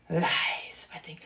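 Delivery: a hushed, unvoiced "Lies"; whispered verdict. Real, not acted. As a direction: breathe it out like a secret.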